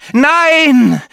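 high, loud drawn-out cry of horror; desperate shout. Acted. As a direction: cry out to stop the crash. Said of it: "rief Maria".

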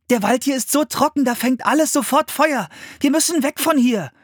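high-pitched, urgent alarm. A narrator's reading. Anne warning her brother to get away, alarmed. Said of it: "rief Anne entsetzt".

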